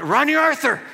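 loud high yell of his own name; mock frustrated yell. Pastor shows what he yells on a miss, loud mock outburst. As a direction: let it fly like a curse. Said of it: "I say".

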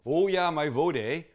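mock Canadian vote, eh; lightly hammed-up Canadian accent. Shown as a demonstration. Cooking host mocks his own Canadian vowel, light accent. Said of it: "that sounded so Canadian".

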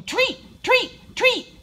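high, loud puppy yaps; barking. A narrator's reading. Puppy racing toward the treat bag, barking. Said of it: "My mouth starts barking".

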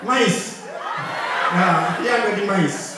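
shouting mice with a laugh; shouted punchline. Real, not acted. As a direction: yell the punchline out to the crowd.

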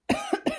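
sharp dry cough; a sudden dry cough; real cough. Real, not acted. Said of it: "and then a cough started".

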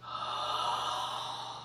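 a big unvoiced gasp for breath; retold gasp for air. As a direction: suck in air like surfacing from water. Surfacing from underwater, gasping air.

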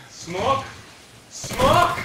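outraged shout. Real, not acted. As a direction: scream it in outrage at the smoke. Friend throws smoke grenade.

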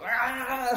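loud grunting shout; battle roar. Retold or imitated. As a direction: roar like lifting heavy. Mimicking a gym grunt.

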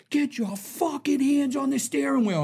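high, slow tough-cop order; imitating a cop giving orders at gunpoint; cop command. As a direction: play the cop barking at the car. Cop pulls gun on him.

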